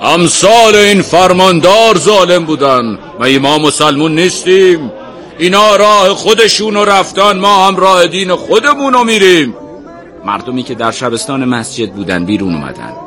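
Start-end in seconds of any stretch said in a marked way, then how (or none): 0.0-9.5 raised, high public oratory; angry, ringing voice. A narrator's reading. Sheikh answering the crowd, angry and resonant. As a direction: rebuke them forcefully, projecting to all. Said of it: "عصبانی و با صدای رسا".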